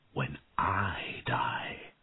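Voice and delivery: slow whisper; remembered dragon's words, slow and whispered; whispered memory. A narrator's reading.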